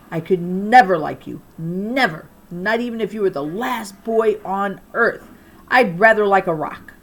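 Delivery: loud cutting put-down; scornful rejection. A narrator's reading. Girl shooting down Jason's crush. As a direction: crush him with every word. Quarrel, she shoots him down.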